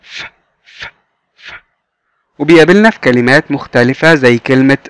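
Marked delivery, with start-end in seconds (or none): 0.0-1.5 ف with light air flowing out; ف breathed out softly; airy f sound. Shown as a demonstration. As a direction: let a little air escape on ف. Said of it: "في هوا بيخرج من بقنا خفيف".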